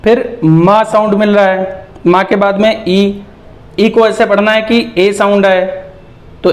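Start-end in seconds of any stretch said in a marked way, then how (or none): none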